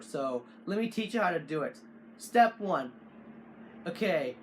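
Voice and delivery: ordinary delivery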